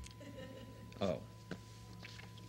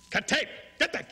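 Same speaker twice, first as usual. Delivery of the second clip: loud high bark at the crew; shouted order; 13 semitones higher, 6 dB louder than usual. Real, not acted. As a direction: yell it to stop the camera.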